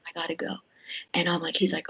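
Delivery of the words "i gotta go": soft, hurried whisper; urgent whisper. Retold or imitated. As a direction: breathe it out, already leaving. Rushing off to the pit.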